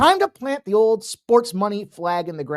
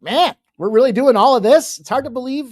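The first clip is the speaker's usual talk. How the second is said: raised pitch, louder amazed exclamation; overwhelmed disbelief; 8 semitones higher, 8 dB louder than usual. Retold or imitated. Host reliving his thoughts on the network's growth, amazed. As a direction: marvel at it as if overwhelmed. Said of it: "sometimes I get overwhelmed".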